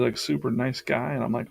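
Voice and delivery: ordinary talk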